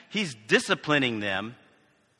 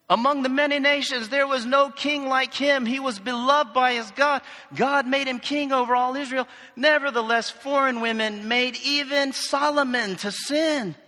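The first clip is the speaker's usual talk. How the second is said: higher, slowing at the end, Bible read aloud; scripture reading; 8 semitones higher than usual. Acted. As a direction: proclaim the verse to the congregation.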